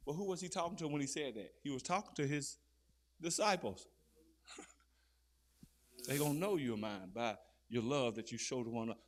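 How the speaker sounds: ordinary talk, nothing marked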